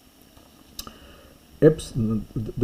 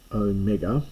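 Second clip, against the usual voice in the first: ordinary talk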